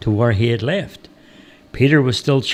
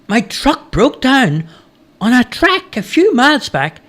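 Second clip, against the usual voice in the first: high-pitched, eager character voice; lost stranger; 12 semitones higher, 3 dB louder than usual. A narrator's reading. Stranger asking the woodcutter the way, high character voice.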